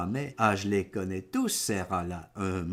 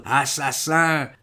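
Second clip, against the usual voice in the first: slow, higher and very loud accusation; cursing shout; 4 semitones higher, 9 dB louder, slower than usual. A narrator's reading. Patient accuses the hospital staff, bellowed cursing shout. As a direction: bellow it out, stretching every syllable. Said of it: "que jure".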